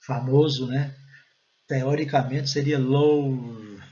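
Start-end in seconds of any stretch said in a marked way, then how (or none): 2.8-3.8 "love" with a long drawn O, like "lohv"; stretched "love" with an OH vowel; wrong rule-based "love". Shown as a demonstration. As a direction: say "love" to rhyme with "stove". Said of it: "Claro que não é".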